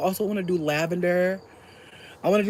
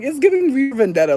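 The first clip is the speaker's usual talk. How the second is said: excited; 8 semitones higher, 6 dB louder than usual. Retold or imitated. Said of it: "I was like".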